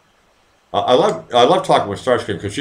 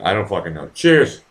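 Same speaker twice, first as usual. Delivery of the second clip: normal talking voice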